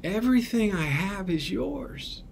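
high, soft, then sinking gentle reply; tender father. Retold or imitated. Father answering his resentful elder son, high and tender. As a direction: reassure him softly, letting it fall. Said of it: "he's like".

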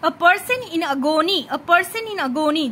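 a person in agony, drawn out slowly; slow recital. Shown as a demonstration. Teacher models slow memorising recitation. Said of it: "তাহলে এইভাবে মনে রাখবে".

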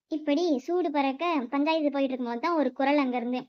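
usual speaking voice

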